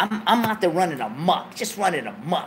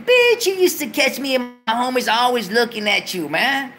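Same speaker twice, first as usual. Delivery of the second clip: high, loud incredulous rant; indignant; 8 semitones higher, 4 dB louder than usual. Retold or imitated. To the girl who says she always wanted him, high and loud. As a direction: throw her words back at her. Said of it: "his retort continued".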